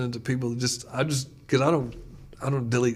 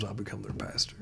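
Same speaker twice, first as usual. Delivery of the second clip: soft whisper, dropped low; 4 semitones lower, 8 dB quieter than usual. Real, not acted. Pastor telling a guest what the title means, whispered. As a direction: let it land like a secret. Lowering voice for the punchline.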